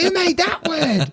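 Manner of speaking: high squeaky complaint; silly high voice. Pretend. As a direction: squeak it up, indignant. Mock complaint about the word.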